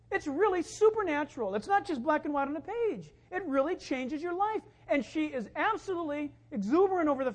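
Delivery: high, loud, fired-up preaching; preaching at full force. Acted. Pastor rouses the congregation with a loud, high-pitched sermon. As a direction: lift the church with a ringing sermon climax.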